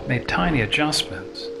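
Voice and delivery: ordinary delivery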